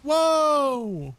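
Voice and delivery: loud, high shout of surprise; startled whoa. Real, not acted. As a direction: caught off guard, yell it out. Sudden shock in game.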